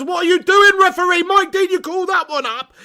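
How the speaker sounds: loud, high outraged yell; angry shout at referee. Real, not acted. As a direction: berate the ref through the TV. Yelling at the referee.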